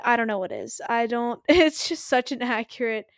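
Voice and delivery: ordinary delivery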